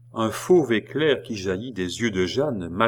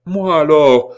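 high, loud sudden cry; desperate outburst; 7 semitones higher, 12 dB louder than usual. Acted. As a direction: burst out in alarm. Jeanne desperate question.

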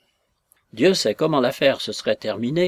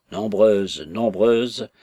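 bass voice; 3 semitones lower, 4 dB louder, much slower than usual. Acted. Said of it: "en basse-taille Kaliopitch".

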